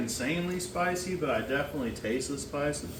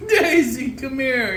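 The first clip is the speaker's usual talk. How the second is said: calling out; 10 semitones higher, 9 dB louder than usual. Real, not acted. Dad calls the little one.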